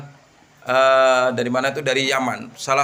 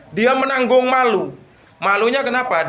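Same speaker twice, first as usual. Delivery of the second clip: emphatic preaching; 9 semitones higher, 3 dB louder than usual. A narrator's reading. Preacher stresses the shame.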